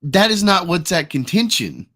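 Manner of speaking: loud, high, stammering mimicry; impression of Ripa. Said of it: "that's a good impression of Ripa".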